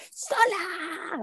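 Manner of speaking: high, thin mock shout; imitating a child's long wail; retold desperate yell. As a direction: yell it high without full volume. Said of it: "Así, mal".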